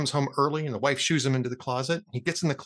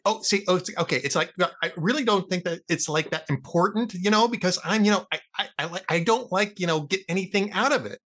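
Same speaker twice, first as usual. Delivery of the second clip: ordinary talk, nothing marked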